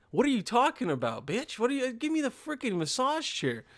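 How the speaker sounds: high incredulous outburst; mock disbelief. Reacting to the trainer's offer, high mock disbelief.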